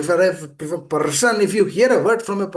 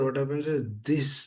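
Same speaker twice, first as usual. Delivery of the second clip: ordinary talk, nothing marked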